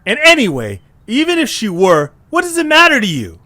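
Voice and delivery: high, loud, rushed protest; flustered denial. Acted. Eche snapping back at Taisene, high and loud.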